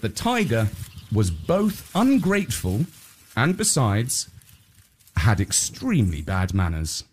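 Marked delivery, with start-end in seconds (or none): none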